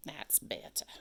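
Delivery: soft unvoiced whisper. Shown as a demonstration. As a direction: say it under your breath. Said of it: "I use weird accents a lot".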